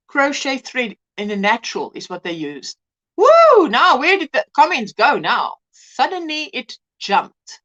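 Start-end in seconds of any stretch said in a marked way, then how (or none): 3.2-3.7 high, loud "woo"; a sudden high whoop; startled whoop. Real, not acted. Streamer reacting to the jumping comments, startled whoop.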